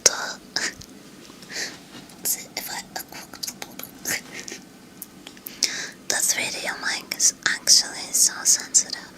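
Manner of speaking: breathy close-mic whisper. Real, not acted.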